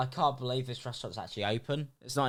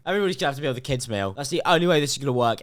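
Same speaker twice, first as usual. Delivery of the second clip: rushed dad ruling; 4 dB louder, much faster than usual. Retold or imitated. Voicing the stingy dad.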